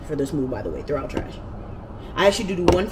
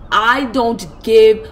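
louder, slow, each word hit; screaming; 2 semitones higher, 7 dB louder, slower than usual. Retold or imitated. Yelling at her brother in front of the movers, slow and loud. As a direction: bite out every word in fury. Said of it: "And I was screaming".

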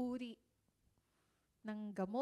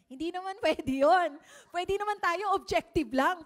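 laughing; 5 semitones higher, 10 dB louder than usual. Real, not acted. Preacher laughs at own question.

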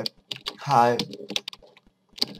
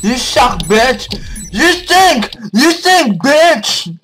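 yelling insults; 9 semitones higher, 6 dB louder than usual. Real, not acted. Yelling insults at game character.

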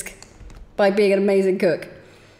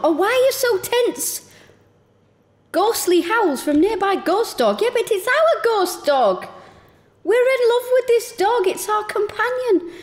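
cutesy pet voice; 11 semitones higher than usual. Real, not acted. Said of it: "I stopped talking like a".

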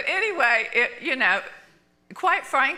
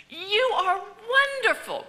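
stranger's gushing praise; 12 semitones higher than usual. Retold or imitated. Stranger praises her at dinner.

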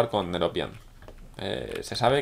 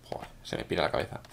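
muttered aside; 7 semitones lower, 7 dB quieter than usual. Real, not acted. Muttering at his own slip.

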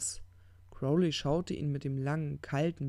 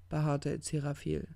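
usual speaking voice

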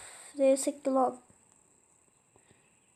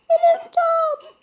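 much higher, louder and slow; high loud squeal; 8 dB louder, slower than usual. Acted. Fox claiming the dog as his own, high loud squeal.

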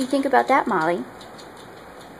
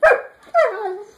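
high-pitched pet talk; 10 semitones higher, 6 dB louder than usual. Real, not acted. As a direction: coo it up high and bright. High excited dog praise.

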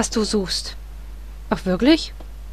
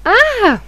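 startled yelp; 10 semitones higher, 4 dB louder than usual. Real, not acted. Startled by game character.

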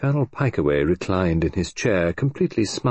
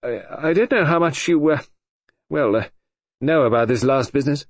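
Sir George; 6 semitones higher than usual. A narrator's reading.

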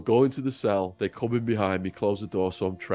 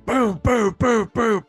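loud, high booming knocks; mock door pounding; imitated pounding knocks; 12 semitones higher, 8 dB louder, slower than usual. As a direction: bang it out like fists on a door.

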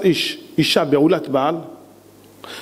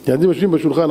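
ordinary talk

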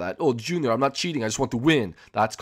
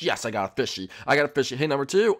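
ordinary delivery

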